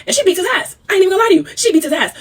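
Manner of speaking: bursting with delight, pitch up; excited. Real, not acted. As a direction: relish the news that she won the fight. Gleeful recap of a fight.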